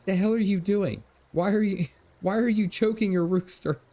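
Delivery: mock indignant father yelling across the yard; dad's voice. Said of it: "he goes".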